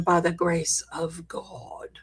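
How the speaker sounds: joking whisper, as if letting you in on a secret; whispered aside. Real, not acted. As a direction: drop to a whisper for the punchline. Joking aside about Ian.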